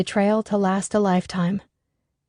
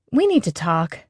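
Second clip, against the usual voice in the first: announcing. A narrator's reading. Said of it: "she announced".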